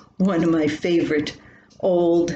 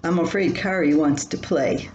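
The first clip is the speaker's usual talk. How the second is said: ordinary delivery, nothing marked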